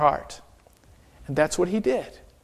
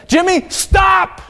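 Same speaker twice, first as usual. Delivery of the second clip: much higher, louder slow shout of stop; sharp shout; 12 semitones higher, 5 dB louder, slower than usual. Retold or imitated. Aretta shouts at Jimmy to stop at the stairs, high and loud. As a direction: yell to freeze a child in place.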